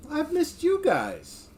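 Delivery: drawn-out and high, fond to the chat; high, slow, fond. Real, not acted. Streamer telling chat viewers he missed them, high and slow.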